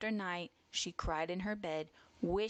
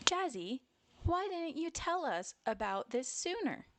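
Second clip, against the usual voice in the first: higher-pitched concerned mother; mother's questioning voice, lifted high; mama bear; 10 semitones higher than usual. A narrator's reading. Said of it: "Mama asked".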